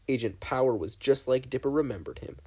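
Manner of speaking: ordinary delivery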